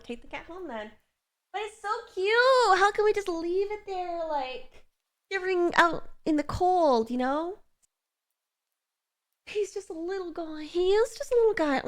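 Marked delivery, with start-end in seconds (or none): 1.5-7.6 pleading coo. Real, not acted. Streamer pleading to chat over a shivering cat, pleading coo. 9.5-11.8 high, cutesy coo; cooing plea. Real, not acted. Streamer sticking up for a stray cat, high cooing plea.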